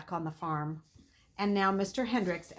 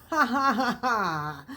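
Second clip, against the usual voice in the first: short bitter mock laugh, louder; 3 semitones higher, 5 dB louder, slower than usual. A narrator's reading. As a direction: scoff it out as a hollow ha ha ha. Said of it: "he laughed bitterly".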